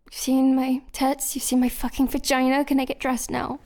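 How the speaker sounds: usual speaking voice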